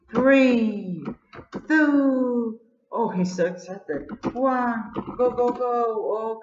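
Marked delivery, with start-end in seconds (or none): 0.1-2.6 high, loud, eager count; excited countdown. Real, not acted. Starting a race with friends, excited countdown. 4.3-6.4 high, urgent go go go; excited countdown. Real, not acted. Race begins, excitedly urging go go go.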